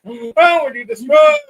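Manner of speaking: high, loud wail of mock despair; mock freak-out crying. Host imitating upset viewers messaging him, mock wailing freak-out. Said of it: "freaking out and crying".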